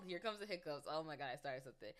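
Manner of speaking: normal talking voice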